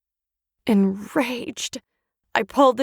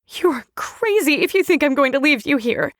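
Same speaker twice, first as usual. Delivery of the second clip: defiant refusal; 4 semitones higher than usual. Acted. Refusing to leave Young.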